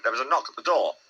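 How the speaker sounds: ordinary delivery, nothing marked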